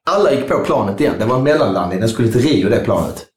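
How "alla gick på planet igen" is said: ordinary talk, nothing marked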